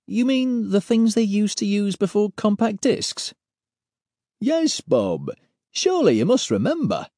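Pete, incredulous and teasing; Pete's voice. Acted. Character dialogue voiced.

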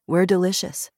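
ordinary delivery, nothing marked

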